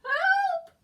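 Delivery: high, very loud cry for help; shout. Acted. Eddie shouting for help from the basket. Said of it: "shouted Eddie".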